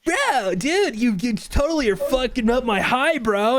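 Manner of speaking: high-pitched whiny stoner bro; put-on high stoner voice; stoner friend. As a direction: complain like a high buddy. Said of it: "you hear his friend go".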